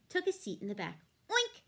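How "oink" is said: high, squealing oink; a quick piggy oink. A narrator's reading. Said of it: "said Pig and Pig".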